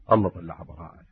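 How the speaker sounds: الله طلع براءة said low and hushed; hushed, almost to himself; hushed first statement. Shown as a demonstration. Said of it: "repeated for emphasis".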